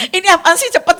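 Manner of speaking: high, loud puzzled retort; loud confused husband; baffled husband. Retold or imitated. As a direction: sound thrown and confused. Husband confused by her remark.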